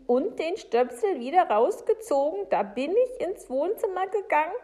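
high, loud, insistent account; agitated Frau Segebrecht. Acted.

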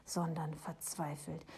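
ordinary delivery, nothing marked

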